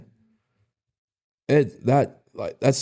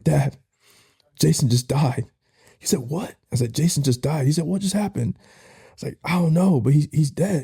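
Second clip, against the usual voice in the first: higher, shaken retelling of the news; distraught call; 6 semitones higher than usual. Retold or imitated. Son calling his dad after his friend died, distraught. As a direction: break the news through shock.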